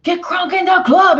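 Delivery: high, loud party shout; hype radio voice. Retold or imitated. Imitating a club song on the radio, loud and high. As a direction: belt it like a club track. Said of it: "wrong radio channel on a Monday morning".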